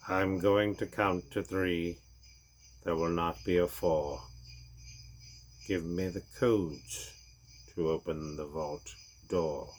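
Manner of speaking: slow, low, cold villain voice; menacing villain. Acted. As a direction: count it out slowly and coldly. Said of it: "said Hans Gruber".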